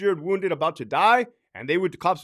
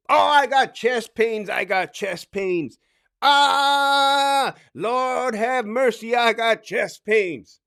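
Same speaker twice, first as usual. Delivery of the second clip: high, drawn-out mock wailing; faked chest pains; 5 semitones higher, 4 dB louder, much slower than usual. Inmate faking chest pains to the officer, hammed-up wail. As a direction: play up fake chest pains, stretching the words. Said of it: "freedom now to kinda act it out".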